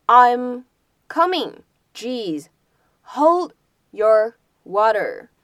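exasperated I'm coming, jeez; teacher acting out impatience; irritated reply. Shown as a demonstration. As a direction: huff it out, annoyed at being rushed. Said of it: "我来了，来了".

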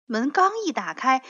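plain reading voice

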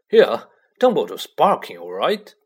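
touch of pride in the voice; proud. A narrator's reading. Ron tells Harry about Dumbledore, proud. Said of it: "said Ron proudly".

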